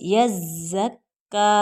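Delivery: यज़्ज़क्का slow with both doublings pressed; correct doubling. Shown as a demonstration. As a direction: model यज़्ज़क्का clearly and slowly. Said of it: "यहाँ पढ़ेंगे यज़्ज़क्का".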